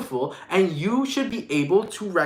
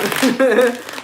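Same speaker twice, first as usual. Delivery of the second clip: bright burst of laughter; short real laugh; 6 semitones higher, 5 dB louder than usual. Real, not acted. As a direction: laugh at your own joke. Laughs at own remark.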